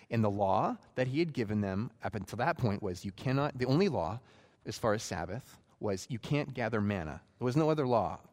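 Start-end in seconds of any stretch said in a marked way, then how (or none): none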